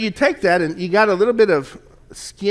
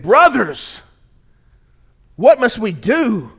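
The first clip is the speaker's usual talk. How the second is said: desperate calling out; 5 semitones higher than usual. Acted. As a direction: cry out to them, begging for any way to be saved. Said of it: "calling out".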